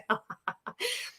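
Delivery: quiet, high real giggle; soft high giggle. Real, not acted. After the coach's slogan, a soft disbelieving giggle. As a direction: let a small disbelieving laugh escape.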